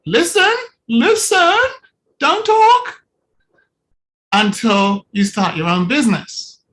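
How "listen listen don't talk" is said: mock a boss silencing Larry; high mock order. Coach mocking a boss telling Larry not to talk.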